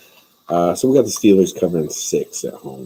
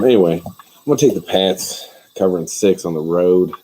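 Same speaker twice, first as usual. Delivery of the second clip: ordinary delivery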